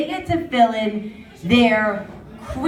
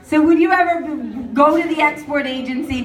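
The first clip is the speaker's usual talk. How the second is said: ordinary talk, nothing marked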